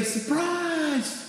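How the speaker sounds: high-pitched, drawn-out mock cheer; surprise shout. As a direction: imitate children popping out to surprise someone. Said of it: "chairs and say".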